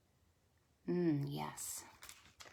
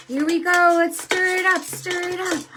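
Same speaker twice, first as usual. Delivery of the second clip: excited singsong; 9 semitones higher, 6 dB louder than usual. Acted. Stirring stew for kids.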